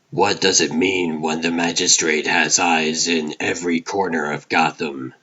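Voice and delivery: ordinary delivery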